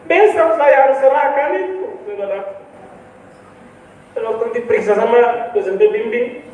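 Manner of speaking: ordinary delivery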